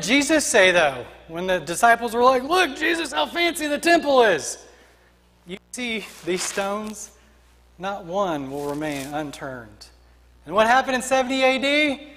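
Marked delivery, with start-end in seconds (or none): 2.4-4.6 high-pitched eager imitation; excited showing-off. Disciples show Jesus the temple. 5.5-9.9 slow, low and soft; sober pronouncement. Acted. Jesus answers the disciples.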